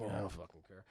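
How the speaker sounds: very low, soft mumble of someone beaten; mock defeated. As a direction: give up under your breath. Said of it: "he's just defeatless".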